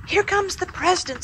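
Muffy squealing with excitement as the helicopter arrives. A narrator's reading. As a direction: squeal it like a thrilled girl. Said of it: "squealed Muffy".

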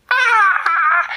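high loud shriek; a startled girl screaming; shrill frightened yell; girl's scream. Acted. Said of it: "she went".

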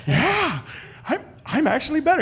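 high, cocky self-praise; mock smug boast. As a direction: puff up, sure of yourself. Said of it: "I got a little cocky".

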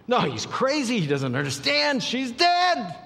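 high-pitched outburst rising on the last words; agitated protest. Acted. Mourners objecting that the girl is dead, agitated protest. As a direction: protest it, jumping high at the end.

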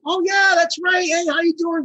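high, enthusiastic hello; suddenly recognize someone and greet them eagerly; imitated high-pitched friendly recognition; delighted greeting. Alex's delighted greeting, acted out.

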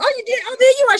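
high and loud, thrilled; mother's delighted greeting. Retold or imitated. Mother opening the door to her daughter, high and delighted.

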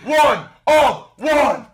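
loud, drawn-out winner call; hype announcer shout. Real, not acted. Streamer telling Michael W he won, loud drawn-out shout. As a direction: roar it slowly, word by word.

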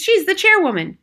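very high, loud protest; incredulous cry. A narrator's reading. Father insisting on the woman's standing, high loud cry. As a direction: protest with pitch soaring. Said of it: "cried Mister Jenkins".

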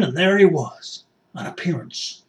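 usual speaking voice

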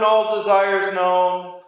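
ordinary delivery

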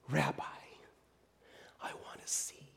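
barely voiced, hushed longing; whispered plea. Blind man answering Jesus, soft whispered plea. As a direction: whisper it like a prayer.

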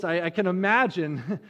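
ordinary talk, nothing marked

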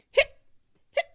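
sharp hics; abrupt hiccup bursts. Acted. Boy still hiccuping after scare, sharp hiccups.

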